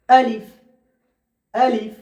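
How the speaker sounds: আলিফ said slowly and clearly, twice; slow careful letter drill; Arabic letter demo. Shown as a demonstration. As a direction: enunciate আলিফ as a teacher modelling the sound. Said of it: "আলিফ যখন উচ্চারণ করব".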